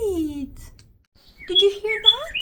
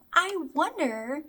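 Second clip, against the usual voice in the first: ordinary talk, nothing marked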